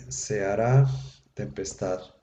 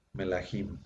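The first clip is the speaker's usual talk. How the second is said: melahim, lower and softer, accent on HIM; stress on final syllable; 4 semitones lower, 10 dB quieter than usual. Shown as a demonstration. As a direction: move the accent to the end of melahim. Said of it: "el acento queda en la última sílaba".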